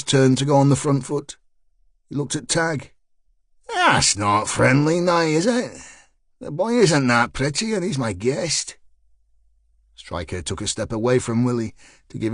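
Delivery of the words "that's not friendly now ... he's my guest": Belfast man chiding the bouncer, mildly menacing; Belfast accent. Acted. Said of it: "continuing his Belfast boy act".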